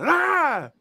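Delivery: high loud boo shout; scare yell. Retold or imitated. Brother jumping out at sister, high loud scare yell.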